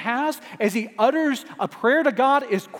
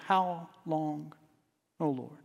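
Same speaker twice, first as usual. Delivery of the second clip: hushed lament; 5 semitones lower, 10 dB quieter than usual. Acted. Soft lament after personal example.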